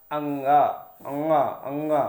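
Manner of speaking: अंगा hummed through the nose; nasal nga. Shown as a demonstration. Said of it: "नाक से आवाज़ निकालनी".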